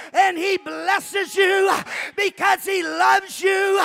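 high, loud shouted exhortation, drawn-out phrases; full-force preaching. Real, not acted. Preacher rousing the congregation to stand and praise, full-force shout. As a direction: hammer each phrase like a revival preacher.

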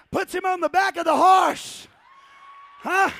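loud, building sermon storytelling; fiery preaching. Acted. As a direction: preach the climax with fire. Preaching at full force.

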